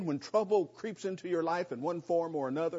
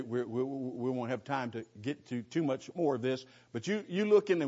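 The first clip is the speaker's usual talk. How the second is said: usual speaking voice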